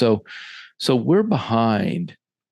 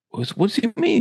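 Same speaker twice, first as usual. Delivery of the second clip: baffled coach; 12 semitones higher than usual. Retold or imitated. Coach baffled by relayed answer.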